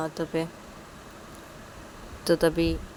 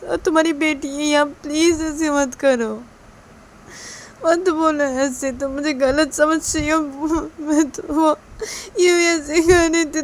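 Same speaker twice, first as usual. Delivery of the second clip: higher, wailing plea with drawn-out words; tearful pleading; 9 semitones higher, 7 dB louder than usual. Acted. Mother begging not to be misjudged before her daughter, tearful pleading. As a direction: beg through mounting sobs, breaking down. Mother begs through tears.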